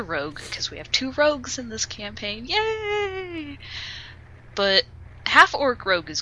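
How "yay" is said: much higher mock cheer; hammed-up sarcastic celebration; sarcastic yay. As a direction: fake a cheer you don't mean. Sarcastic cheer about two rogues.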